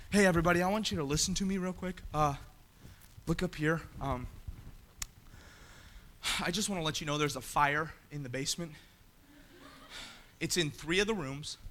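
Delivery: soft, offhand voice as if nothing's wrong; casual fire announcement. Retold or imitated. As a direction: pretend the fire is no big deal. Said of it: "if I said".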